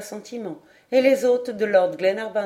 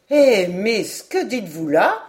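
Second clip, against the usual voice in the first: louder, astonished captain; surprised exclamation; 2 semitones higher, 7 dB louder than usual. A narrator's reading. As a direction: exclaim in mild disbelief. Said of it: "s'écria John Mangles". (exclamation étonnée, plus forte)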